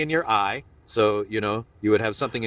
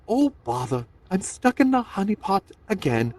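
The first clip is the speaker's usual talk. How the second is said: high, sing-song Pooh voice stuck in honey; Winnie the Pooh; 8 semitones higher than usual. Acted. Comedian voicing Pooh to the crowd, high and whiny. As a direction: play Pooh whining about the honey pot.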